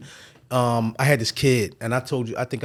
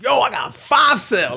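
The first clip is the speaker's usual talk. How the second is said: high, loud and slow celebratory shout; hollering with joy over five sales; excited shout; 10 semitones higher, 3 dB louder than usual. Retold or imitated. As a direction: call out the win, thrilled. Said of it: "I was like".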